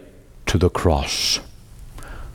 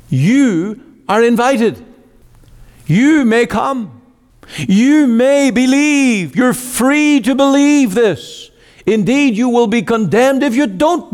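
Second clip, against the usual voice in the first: rising preaching; 6 semitones higher than usual. Acted. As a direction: lift each line up to the congregation. Preacher rises to a climax.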